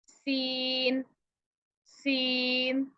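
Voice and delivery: सीन said light and flat-tongued; light seen. Shown as a demonstration. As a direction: keep सीन light and straight. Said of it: "आराम से आपकी आवाज़". (हल्की सीधी आवाज़ में सीन)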